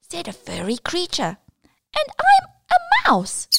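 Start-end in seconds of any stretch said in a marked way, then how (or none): 2.0-3.5 very high fast squeak; mouse voice. Acted. Mouse introduces itself.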